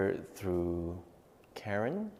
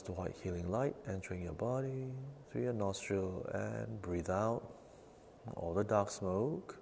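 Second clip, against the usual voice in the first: guided breathing meditation; 11 dB quieter than usual. Acted. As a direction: calmly guide each breath, fading to near whisper. Leads nine-round breathing meditation.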